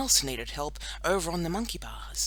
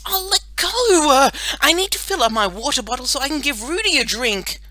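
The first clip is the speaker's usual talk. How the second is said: annoyed protest; 10 semitones higher, 9 dB louder than usual. A narrator's reading. Girl fights boy for her water bottle, irritated. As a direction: complain while tugging against him.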